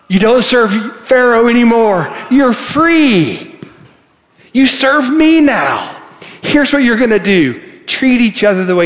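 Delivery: God shouting to freed slaves, ringing and exultant; booming voice of God. Acted. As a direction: announce freedom like God calling out. Preacher voices God to Israel.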